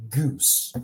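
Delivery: ordinary talk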